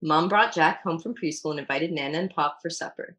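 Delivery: ordinary delivery, nothing marked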